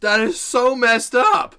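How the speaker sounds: loud, high, drawn-out exclamation; loud reaction. Real, not acted. Listener reacting to a creepy grandma joke, loud exclamation.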